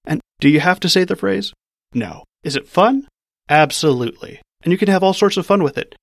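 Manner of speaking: ordinary talk, nothing marked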